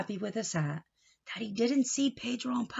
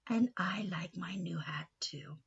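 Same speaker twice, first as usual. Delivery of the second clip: whispered reply; 3 semitones lower, 7 dB quieter than usual. Acted. Uncle Nacho answers.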